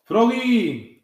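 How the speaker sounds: loud high shout across the field; yelling. A narrator's reading. Dad yells to Froggy from the stands, loud shout. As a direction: yell his name from the stands. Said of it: "gritó su papá".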